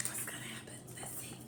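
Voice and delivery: hushed suspense whisper; breathless storyteller whisper. A narrator's reading. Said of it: "whispered aside to listeners".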